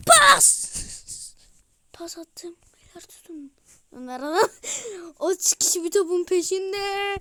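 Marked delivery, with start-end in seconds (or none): 0.1-0.7 loud, high shout for a pass. Real, not acted. Pas isteyen bağırış. 1.9-3.5 soft unvoiced whisper. Real, not acted. Fısıltı.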